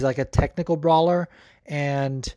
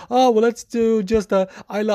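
higher, louder Swedish accent impression; 7 semitones higher, 3 dB louder than usual. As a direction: lightly imitate Jack's Swedish accent. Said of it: "He was like".

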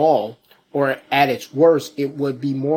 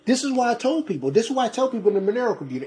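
high fast rant; 9 semitones higher, much faster than usual. Real, not acted. Rant speeding up.

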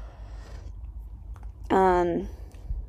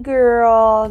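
mock loud call; 4 semitones higher, 10 dB louder than usual. Mocking greeting to ghost.

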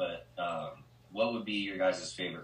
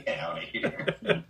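laughing; 11 semitones higher, slower than usual. Real, not acted. Laughing disbelief at a joke.

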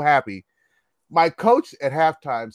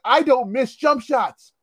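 high, emphatic protest; dead serious insistence; 9 semitones higher, slower than usual. Retold or imitated. Cocky freshman arguing with ref, earnest indignation. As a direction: insist on it slowly, utterly convinced. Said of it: "I was dead serious".

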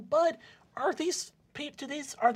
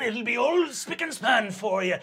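ordinary talk, nothing marked

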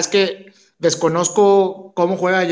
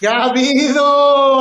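slow, stretched, higher hello; drawn-out greeting; 7 semitones higher, 5 dB louder, slower than usual. Real, not acted. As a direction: draw the greeting out loudly. Greeting on joining the stream.

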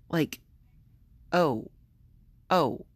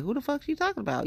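high, quick mimicry of an audience; mock the confused onlooker's question; puzzled listener; 10 semitones higher, faster than usual.

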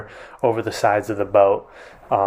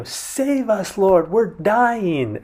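pitched up, slowing on the cry for help; retold shout; 8 semitones higher, much slower than usual. Disciples crying out to Jesus over the wind, mock shout. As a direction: call out as if over the storm. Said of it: "they're shouting out".

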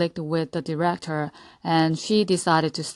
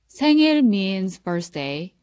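ordinary talk

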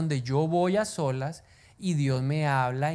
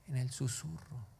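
much softer, lower; 4 semitones lower, 13 dB quieter than usual. Shown as a demonstration. Preacher answering his own question, hushed. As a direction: let it fall to a hush. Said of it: "en el ¿qué?".

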